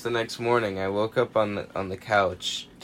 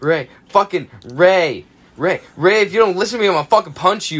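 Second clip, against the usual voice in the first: louder, higher, half-joking threat; loud drunk threat; 5 semitones higher, 6 dB louder than usual. Retold or imitated. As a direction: yell it like an annoying drunk kid. Threatening drunk friend for attention.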